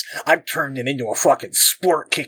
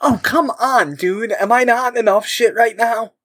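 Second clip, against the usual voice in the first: exasperated; 9 semitones higher than usual. Real, not acted. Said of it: "This is what I'm worried about".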